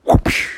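quick spoken swoosh effect; breathy cartoon whoosh; imitated whoosh. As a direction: make a short whooshing noise. Noise of pulling out homework.

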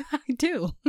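slow laughing answer; laughing reply to God; laughing yes. Pretend. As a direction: sass back with a giggle. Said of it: "And I'm like".

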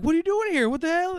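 high-pitched baffled questioning; acted astonishment. He grabs her after the chase, high astonished questioning. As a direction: demand it in mock disbelief.

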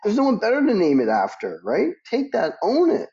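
retold pep talk to Judy, high and insistent; emphatic encouragement. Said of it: "I go".

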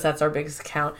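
low, hushed amazement; awed hush. Retold or imitated. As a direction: say it in stunned awe. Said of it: "he goes".

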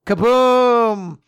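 stretched mock detonation; imitated explosion. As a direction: play the blast as a vocal sound effect.

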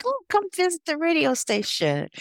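very high, singled-out mock-inviting voice; acted coaxing invitation. Student recruiter luring her to the station, high imitated coaxing.

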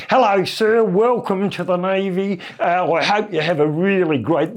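a daft overeager sailor greeting an officer; the silly voice. Retold or imitated. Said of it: "I think I might do the voice on him".

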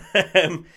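short loud laugh; burst of laughter. Real, not acted. Laughs at own joke.

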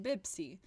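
"Bibsi" with B sounds for both Ps; Arabized pronunciation. Retold or imitated. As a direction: swap each P for a B, "Bibsi". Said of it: "And I was like".

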